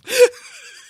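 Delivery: very high loud laugh; embarrassed squeal of laughter; shrieking laugh. Real, not acted. Realizes she missed episode.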